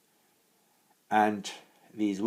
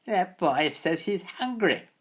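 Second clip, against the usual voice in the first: put-on high, loud jeering old witch; mocking hag; 14 semitones higher, 9 dB louder than usual. A narrator's reading. Old hag mocking the hungry boy, high and jeering. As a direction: sneer it out like a spiteful crone.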